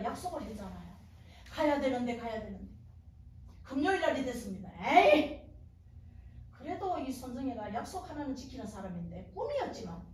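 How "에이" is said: short, loud burst of resolve; exasperated "ey!". Retold or imitated. Woman giving in to her promise, exasperated shout. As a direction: blurt it out, giving in.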